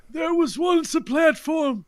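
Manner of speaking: put-on high, creaky old man voice. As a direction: tell it like an ancient old-timer. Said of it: "doing the old man voice again".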